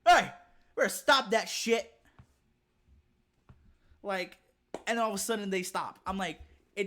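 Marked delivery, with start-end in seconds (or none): 0.1-1.9 loud, high shout from another room; angry yell. Retold or imitated. Parent yelling from the back at misbehaving kids, angry shout. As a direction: yell at the kids to stop, full force. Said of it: "you hear from the back".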